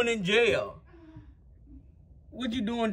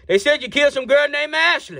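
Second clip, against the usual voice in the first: high-pitched yelled accusation; yelling; 8 semitones higher, 4 dB louder than usual. Acted. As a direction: yell it across to someone behind glass. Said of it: "can you stop yelling at me?".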